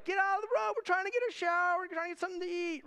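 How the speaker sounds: high, loud mock hollering; joking impatient yell. Tired rider yelling at cyclists in the way, mock-impatient holler. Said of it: "you feel that way".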